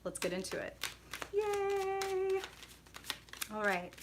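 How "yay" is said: cheer. Real, not acted. Cheering before the reading.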